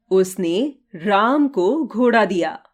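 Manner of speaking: pause, then राम lifted out; single out राम as the receiver; stress on राम. Shown as a demonstration. Teacher demonstrating sentence stress for students, stress on राम. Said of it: "रंगीन शब्दों पर बारी-बारी से जोर देकर".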